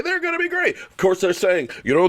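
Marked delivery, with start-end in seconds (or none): 0.0-0.8 high-pitched parody of a gushing pundit; mock-enthusiastic squeal. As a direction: mimic their hype in a raised voice. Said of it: "and say".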